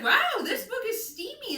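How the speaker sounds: high, loud mock shock; scandalized delight. Telling a friend about a steamy audiobook, high loud mock shock. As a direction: squeal that the book is steamy. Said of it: "I was like".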